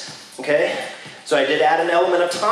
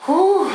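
loud relieved whew; 12 semitones higher, 4 dB louder than usual. Real, not acted. Exhale after heavy set.

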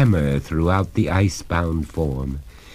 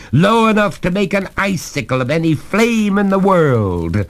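lightly voiced master, higher pitch; relentless, building verdict; gloating master; 11 semitones higher, 3 dB louder than usual.

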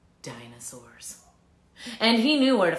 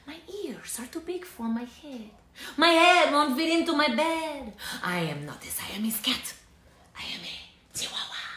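put-on a light Spanish accent, half-hearted; lightly put-on Spanish accent; 6 semitones higher than usual. Kitty boy telling the mirror who he is, light Spanish accent. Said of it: "using his very best Spanish accent".

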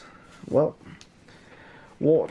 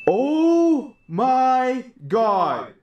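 louder, higher, very slow oh my god; stunned excitement; 11 semitones higher, 4 dB louder, much slower than usual. Real, not acted. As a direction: let the disbelief stretch out. Pulls the gold Zacian card.

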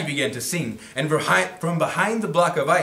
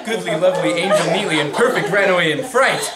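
excited; 5 semitones higher, 6 dB louder than usual. A narrator's reading. Penguins flee in fright.

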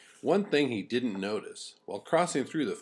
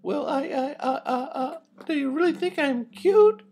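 put-on much higher, shy halting girl lamb; stuttering lamb; 6 dB louder than usual. A narrator's reading. Lamb flattered by the wolf, high stammering. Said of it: "Loretta stuttered".

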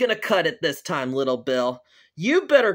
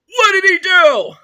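high, loud burst of alarm; shocked demand; 11 semitones higher, 11 dB louder than usual. Acted. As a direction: shout it in outraged disbelief. Father hears of son's misdeed.

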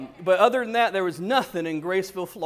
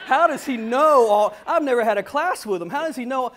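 higher, louder, baffled and amused; incredulous mock outburst; retold disbelief; 5 semitones higher, 6 dB louder than usual. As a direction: act out being freaked out, half laughing. Retold astonished reaction to professor.

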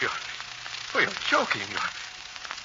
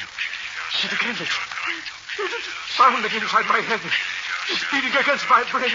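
1 rising panic; 6 semitones higher than usual. Acted. Hearing voice in his head.

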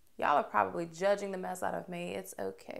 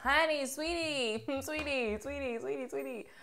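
playful cooing; 5 semitones higher, 4 dB quieter than usual. Real, not acted. Cooing at her reflection.

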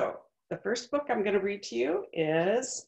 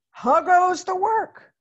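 higher, louder, slow farmer's call; 11 semitones higher, 10 dB louder, slower than usual. Acted. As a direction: call out lazily from bed. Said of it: "called the farmer".

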